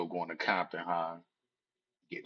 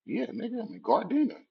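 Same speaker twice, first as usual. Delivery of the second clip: much higher defiant answer; defiant retort. Retold or imitated. Young man answering a challenge, high defiant retort. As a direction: throw it back at her.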